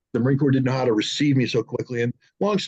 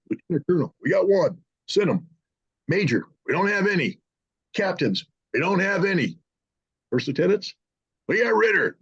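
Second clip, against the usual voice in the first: mock brass roll call; 5 semitones higher than usual. Officials going down the ranks.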